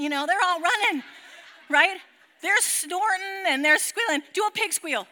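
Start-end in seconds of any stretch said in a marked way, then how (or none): none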